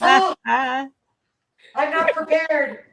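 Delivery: high, loud hammed-up protest; mock-flustered Carol. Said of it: "Like, she's like".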